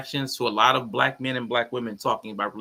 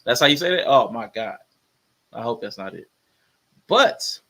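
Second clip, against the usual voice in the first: ordinary talk, nothing marked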